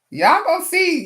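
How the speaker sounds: high, loud playful warning; excited "y'all gonna see"; hyped tease. Real, not acted. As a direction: tease the viewers, pumped.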